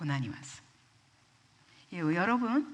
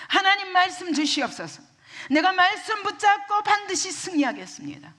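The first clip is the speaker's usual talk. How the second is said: high, forceful prayer of resolve; resolute vow; 10 semitones higher, 5 dB louder than usual. Acted. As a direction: declare it loudly, as a vow to God. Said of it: "그리고 결심해야 됩니다". (결단하며 높고 힘차게 기도하듯)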